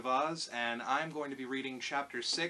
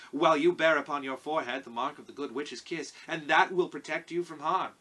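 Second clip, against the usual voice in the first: lightly voiced character, projected louder; Tin Woodman; 3 semitones higher, 8 dB louder than usual. A narrator's reading. Tin Woodman reassures Dorothy about the journey, louder character voice. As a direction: speak up firmly in a light character voice.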